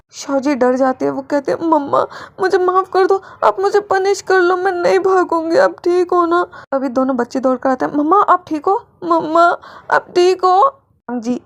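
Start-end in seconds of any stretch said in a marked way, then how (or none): 1.7-6.4 put-on high, anxious little girl begging forgiveness; a scared daughter begging her mother to be okay; frightened child pleading. 7.9-10.6 put-on high, anxious little girl begging forgiveness; a scared daughter begging her mother to be okay; frightened child pleading.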